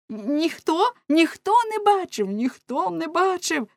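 high-pitched cry of an outraged crowd. A narrator's reading. Angry townspeople denying there was a witness, crying out. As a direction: cry it out high, insistent, as the whole mob. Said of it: "кричав народ".

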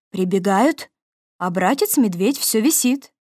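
plain reading voice

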